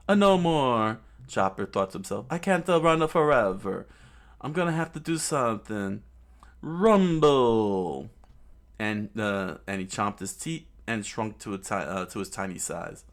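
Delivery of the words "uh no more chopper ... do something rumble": louder, higher hero's resolve; determined fighter. Acted. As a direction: psych yourself up mid-battle. Chopper fighting, thinking aloud.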